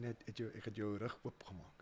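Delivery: soft whispered apology; whispered confession. Retold or imitated. Wife apologising to husband after dinner, whispered. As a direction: breathe it out as a hushed confession.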